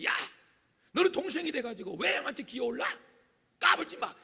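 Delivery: high, loud acted telling-off; scolding mother. Mother scolding younger brother for defying older one, high and loud. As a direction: put the younger child firmly in place. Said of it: "동생에게 말합니다".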